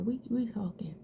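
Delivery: whispered. Shown as a demonstration. To viewers taking their time on brows, whispered. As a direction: repeat We, we talking in a whisper.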